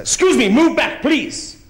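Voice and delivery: high, loud officious command; bossy Dutch driver. Retold or imitated. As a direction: bark it like a pushy chauffeur. Dutch driver insisting again.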